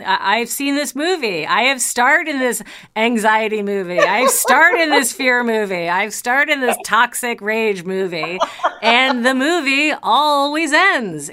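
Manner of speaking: punchy, rising declaration; emphatic build. Real, not acted. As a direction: build it like a rallying cry. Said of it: "building rhetorical run".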